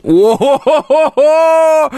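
high, loud mocking ho-ho laugh. Real, not acted. Mocking the request. (громкий насмешливый хохот высоким голосом)

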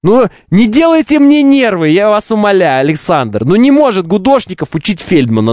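lightly put-on Odessa Russian accent; a hint of an Odessa Russian accent. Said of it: "Александр Фельдман".

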